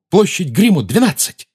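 loud high shout into the fire. Acted. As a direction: call out the address loudly. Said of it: "крикнул".

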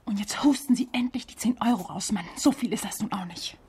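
unvoiced whisper, pressing and insistent; hissed demand. Demanding the ten euros from the official, hissed whisper. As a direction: hiss it at him so nobody else hears.

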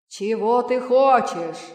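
slow, loud genie voice; booming thunder voice. A narrator's reading. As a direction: boom it out like thunder. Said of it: "спросил джин голосом, подобным грому".

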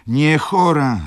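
loud, high outburst from the baroness; exclaimed protest. A narrator's reading. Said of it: "zawołała baronowa". (głośny, wysoki okrzyk sprzeciwu)

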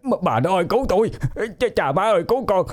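high, wailing panicked pleas; crying for help. A narrator's reading. Man crawling from the demon calls to family and neighbours, wailing in terror. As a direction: stammer and wail, climbing into a thin high cry. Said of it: "vừa lết vừa khóc, miệng thì vẫn la bài hải".

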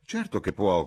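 mild cry of agreement. A narrator's reading. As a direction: exclaim it heartily. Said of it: "gridò Sir Harry".

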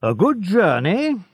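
high, bright farewell call; cried out. A narrator's reading. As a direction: call after him with false cheer. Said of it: "he cried".

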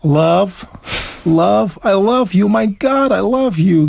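gushing American accent. Shown as a demonstration. Teacher showing how Americans would say it, American accent. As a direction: put on an enthusiastic American voice. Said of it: "Amerykanie jakby powiedzieli".

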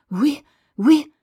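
usual speaking voice

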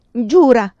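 high, very loud shout; shouted demand. A narrator's reading. Vinca crying out to Pilar to swear, shouting. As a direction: shout it as an urgent order. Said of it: "le gridò Vinca".

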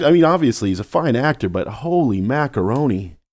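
ordinary talk, nothing marked

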